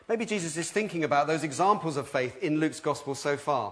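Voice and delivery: normal talking voice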